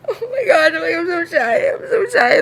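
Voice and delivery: high, loud whiny cry of shyness; half-laughing fake wail about being shy; hammed-up shy wail; mock shy whine.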